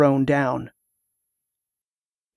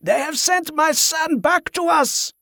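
proclaiming; 12 semitones higher than usual. A narrator's reading. Pirate proclaims to crowd.

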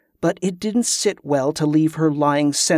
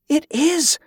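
much higher, excited voice; astonished recognition; 12 semitones higher than usual. A narrator's reading. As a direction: exclaim in high-pitched recognition. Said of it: "Kerla said".

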